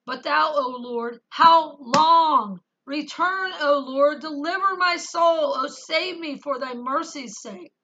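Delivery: loud, slow, pleading scripture recitation; fervent psalm reading. Acted. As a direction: cry the verses out to God. Reads psalm aloud.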